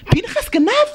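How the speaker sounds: high, shocked question; angels asking. Acted. Angels asking in shock whether Pinchas stole. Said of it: "שואלים המלאכים".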